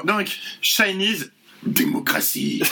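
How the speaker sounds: slow, solemn bad-news voice; grave announcement. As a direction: announce it like a terminal diagnosis. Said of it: "sur le même ton que quelqu'un qui annonce une terrible nouvelle".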